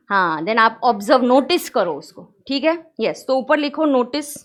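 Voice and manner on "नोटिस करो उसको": usual speaking voice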